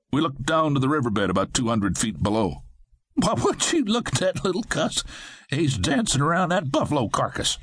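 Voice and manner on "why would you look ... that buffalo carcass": high voice with a chuckle in it; Fred amused, pointing out the dancing man, chortling. A narrator's reading. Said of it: "Fred chortled".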